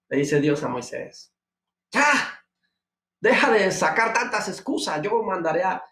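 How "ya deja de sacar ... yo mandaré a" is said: higher, exasperated rebuke; angry God. Acted. Preacher voicing God fed up with Moses, exasperated rebuke. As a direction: scold him to quit the excuses. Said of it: "Y Dios ahí se enojó".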